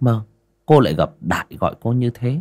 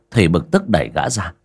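plain reading voice

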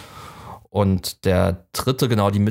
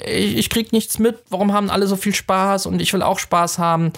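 high, whiny lament, much higher than her own voice; mock sad complaint; 10 semitones higher than usual. Blind brother complaining he misses the fun, high whiny voice. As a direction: play the left-out brother, high and plaintive. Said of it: "ist so total traurig und sagt so".

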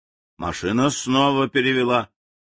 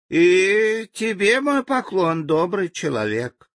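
simple native's greeting; 8 semitones higher, slower than usual. Acted.

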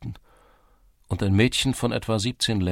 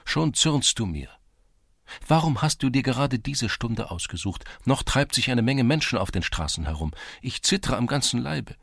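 a higher, lightly voiced young girl, a little anxious; 6 semitones higher than usual. A narrator's reading. Girl fretting to her Cossack, lightly higher voice. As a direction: read the shy girl a touch higher, worried about being seen. Girl's voice in the story.

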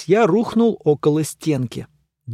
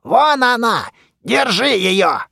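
shouting; 7 semitones higher, 3 dB louder, slower than usual. A narrator's reading. Mumiy yelling during the chase.

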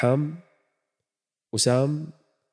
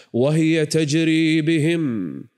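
drawn-out, louder recited verse; slow Quran recitation; 3 semitones higher, 4 dB louder, much slower than usual. Acted. Preacher recites the ship sailing through waves, slow. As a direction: stretch each word as in recitation.

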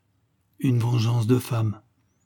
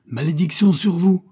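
loud, higher cursing cry; furious curse; 7 semitones higher, 6 dB louder than usual. A narrator's reading. Widow cursing the chemists who refused her, furious. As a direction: hurl the curse at them. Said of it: "cria la pauvre jeune femme".

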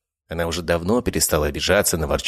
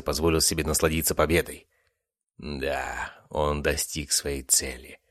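usual speaking voice

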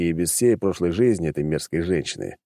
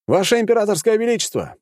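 higher, louder calling out to the emperor. Acted. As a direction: call through the door. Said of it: "громко крикнул я".

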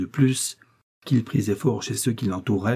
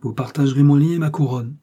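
"vous partagerez mon lit et ma couronne" raised and louder; emphatic, higher delivery of "vous partagerez mon lit et ma couronne"; weighty emphasis; 5 semitones higher, 5 dB louder than usual. A narrator's reading. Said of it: "comme pour donner plus de poids à ses paroles".

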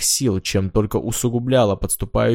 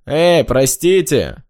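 loud, higher, drawn-out call for attention; interrupting; 5 semitones higher, 5 dB louder, much slower than usual. A narrator's reading. Hanna cuts into their dialogue, calling out loudly. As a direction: cut in loudly, stretching the words. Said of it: "вклинилась в их диалог Ханна".

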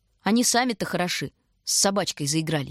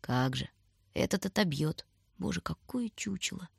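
gloomy inner thought; 7 dB quieter than usual. A narrator's reading. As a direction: think it gloomily under your breath. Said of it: "мрачно думала она".

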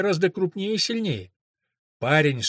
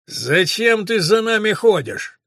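put-on drunk menacing man; 5 semitones higher, 5 dB louder, slower than usual. Drunk attacker questioning Den.

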